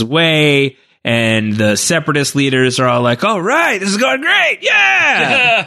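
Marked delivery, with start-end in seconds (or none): 3.2-5.7 high shouted mock celebration; mock cheering. Separatist leaders gloating before Anakin arrives, high shouted cheer. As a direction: cheer like cocky villains about to lose.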